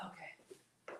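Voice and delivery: unvoiced whisper of the name; awed whisper. Real, not acted. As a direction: whisper it reverently. Reverent saying of name.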